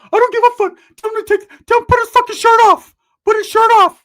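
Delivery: high-pitched screaming character, very loud; Ricky's outraged shriek. Acted. Ricky yelling orders.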